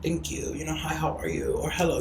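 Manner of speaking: soft, composed polite greeting; put together. Acted. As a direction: greet them quietly, keeping it together. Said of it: "You gotta keep it put together".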